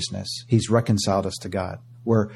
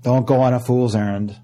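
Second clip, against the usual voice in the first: ordinary talk, nothing marked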